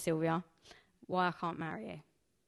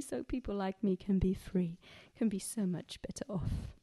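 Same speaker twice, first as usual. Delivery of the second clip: whisper; 3 dB quieter than usual. Acted. Echoed wistful aside in poem.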